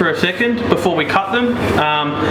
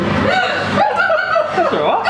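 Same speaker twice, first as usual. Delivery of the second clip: laughing; much slower than usual. Real, not acted. Laughing at own slip.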